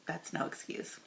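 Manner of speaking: soft breathy aside under the breath; whispered aside. Real, not acted. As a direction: drop to a dry whisper. Sarcastic aside while reading apology.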